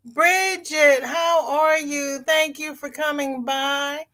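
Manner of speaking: high-pitched welcoming singsong; delighted greeting. Real, not acted. Streamer welcoming a viewer who came by, delighted greeting. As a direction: greet them in a high, drawn-out coo.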